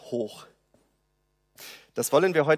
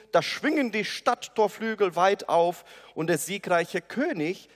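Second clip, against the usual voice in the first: ordinary talk, nothing marked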